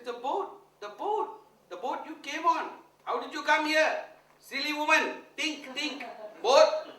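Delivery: high, loud, slow impatient scolding; exasperated scolding. Retold or imitated. Guru scolding the milkmaid about the boat, high and loud. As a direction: lecture a silly woman.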